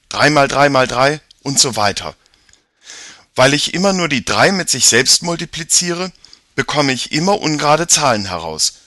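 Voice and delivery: put-on an eager boy explaining; boy character voice. Jakob explains powers of three.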